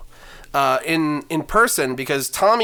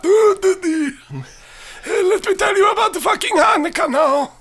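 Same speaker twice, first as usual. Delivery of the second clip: put-on very high, loud accented old lady; kvetching grandma storytelling; old Yiddish grandma; 4 dB louder than usual. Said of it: "so they're not".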